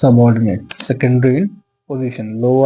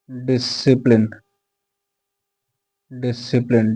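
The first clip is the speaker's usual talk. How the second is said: slow dictation; much slower than usual. Shown as a demonstration. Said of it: "slow dictation".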